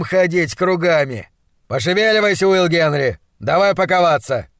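loud, rallying doctor voice; brisk, upbeat monstrologist. Acted. Doctor rousing his assistant to break camp, upbeat.

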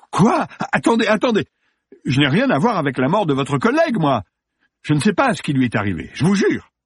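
high-pitched frantic protest; panicked denial. Acted. Actor suspected of a colleague's death, panicked.

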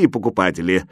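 plain reading voice

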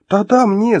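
high, loud, eager yes; submissive agreement. Acted. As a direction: agree at once, anxious to appease. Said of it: "покорно соглашаясь".

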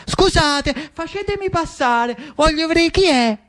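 high-pitched, eager pleading voice; curious Salvatore. Acted. Curious Salvatore squeezing through the crowd to look, high and insistent.

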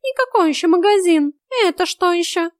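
high, loud cat voice; high-pitched, loud questioning; irritated cat. A narrator's reading. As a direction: scoff at the unknown word.

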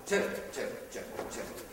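dropping to a whisper, certo, certo; muttered certo, fading to a whisper; muttered concession. Acted. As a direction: concede it under the breath, fading. Priest concedes under breath.

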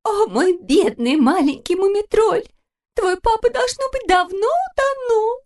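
high, slow crying lament; crying mama. A narrator's reading. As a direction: wail for the lost papa in tears. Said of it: "расплакалась".

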